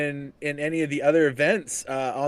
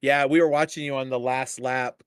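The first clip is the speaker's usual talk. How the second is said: ordinary talk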